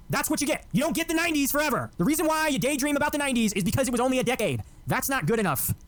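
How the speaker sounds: a heated rant against conservatives; getting real angry. Real, not acted. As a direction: let the anger climb high in the voice. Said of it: "Man, he's getting real angry".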